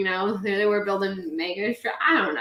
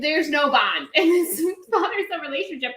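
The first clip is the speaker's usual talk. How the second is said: ordinary talk, nothing marked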